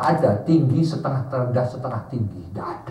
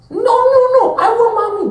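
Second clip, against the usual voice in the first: put-on high, loud child refusing; whining child; 9 dB louder than usual. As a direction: wail like a stubborn little kid. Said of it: "child voiced in story".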